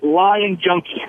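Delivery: ordinary delivery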